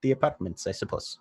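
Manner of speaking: lightly voiced Russian accent; a hint of a Russian accent.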